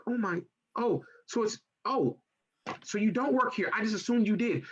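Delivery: high, flustered mock-embarrassed lady voice. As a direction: backpedal in a high startled voice. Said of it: "And she's like".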